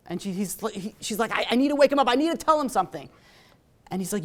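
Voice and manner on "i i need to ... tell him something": urgent plea. Retold or imitated. Crying wife pleads with nurse.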